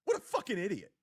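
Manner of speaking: lightly voiced mock Carl catchphrase; a hint of Carl's jab, said twice; imitating Carl. Host imitates rival Carl's catchphrase to mock him. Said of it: "That's another one he always says".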